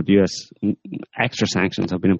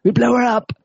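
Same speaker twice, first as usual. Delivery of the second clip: mock jubilant jihadi. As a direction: cheer like a giddy East End lad, laughing, pitched way up. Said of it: "running down the street shouting".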